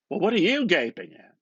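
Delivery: McCoy buttonholing Bloom, loud and eager; McCoy's voice. Acted. McCoy's voice breaks in.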